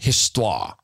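histoire said the French way, his-TWAH. Retold or imitated. Radio host's histoire, said his-TWAH. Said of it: "Who said that on the radio a lot?".

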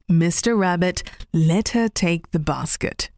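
ordinary delivery

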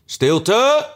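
rabbit shouting. A narrator's reading. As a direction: bark a high call for silence. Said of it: "want het witte konijn riep luid".

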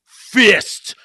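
low, mock-solemn line; mock dramatic ending. Mocking the finale to friends, low and mock-solemn. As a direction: parody a cheesy finale. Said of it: "they're like".

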